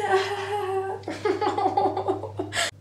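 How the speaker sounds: long, high-pitched laugh. Real, not acted. Vlogger stuck with a ripped-tag purchase, long high laugh. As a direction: laugh high and keep it going.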